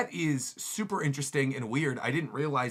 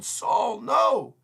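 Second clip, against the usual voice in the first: high, loud call of alarm; acted cry of alarm; 9 semitones higher, 6 dB louder than usual. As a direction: cry out to stop him. Children cry out for Saul.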